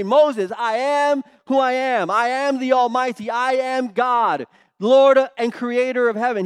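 a hammed-up lordly decree; booming proclamation. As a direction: announce it like an almighty king. Imagined grand divine self-introduction.

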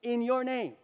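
exultant disciples, lightly acted; joyful report. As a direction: proclaim it slowly and joyfully, with force. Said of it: "the seventy-two returned with joy".

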